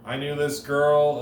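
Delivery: ordinary delivery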